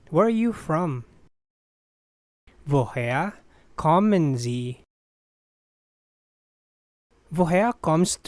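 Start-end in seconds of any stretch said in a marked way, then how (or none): 2.6-4.8 careful, spaced-out teaching model. Shown as a demonstration. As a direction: break the question in two for learners. Teacher repeats slowly with pause.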